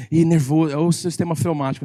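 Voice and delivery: ordinary delivery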